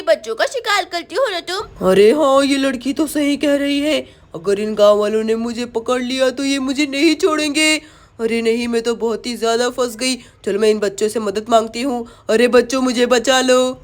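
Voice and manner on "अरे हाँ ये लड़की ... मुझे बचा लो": much lower, worried witch. Acted. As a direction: plead as the witch. Witch begs children for help. (मदद माँगती चुड़ैल)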